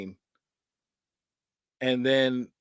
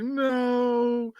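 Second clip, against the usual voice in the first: frightened refusal; 9 semitones higher than usual. Retold or imitated. Taryn seeing Freddy's syringe fingers, high whimpered no. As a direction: plead against the syringes. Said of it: "she's like".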